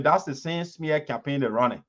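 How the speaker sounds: ordinary delivery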